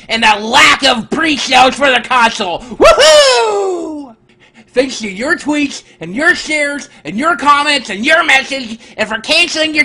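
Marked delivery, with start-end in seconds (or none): 2.6-4.1 high joyful cheer; excited whoop. Real, not acted. Cheering after good news.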